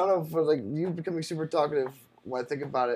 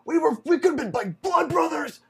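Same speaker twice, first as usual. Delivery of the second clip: mock heartbroken drunk; 8 semitones higher than usual. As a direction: wail it like a betrayed brother. Mocking drunk sentimental texts again.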